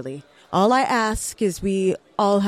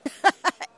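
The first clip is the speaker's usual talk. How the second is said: evil laugh; 9 semitones higher than usual. Acted. Villain reveals poisoning.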